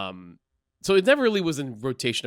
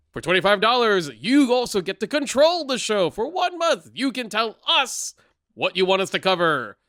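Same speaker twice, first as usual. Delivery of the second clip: announcer voice; 3 semitones higher than usual. Acted. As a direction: sell it like a radio ad. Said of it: "I should just keep do the announcing thing".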